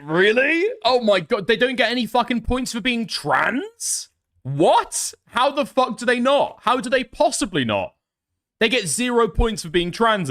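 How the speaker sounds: can't believe what he's reading; outraged disbelief. Real, not acted. Outraged at scoring rules.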